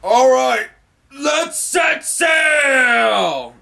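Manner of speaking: high, loud, drawn-out rallying cry; Luffy rallying the crew as the ship departs, a big shout; triumphant shout. Retold or imitated. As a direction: belt it out like setting off on an adventure. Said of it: "Luffy goes".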